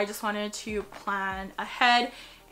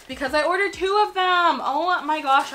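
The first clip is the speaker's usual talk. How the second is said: surprised; 5 semitones higher, 6 dB louder than usual. Real, not acted. Surprised at double order.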